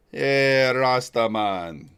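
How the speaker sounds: put-on loud, drawn-out Rasta accent; mock Rasta accent. Streamer clowning with accents, loud mock Rasta accent. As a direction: belt it out in a playful Rasta accent, stretching it. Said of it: "I do accents sometimes".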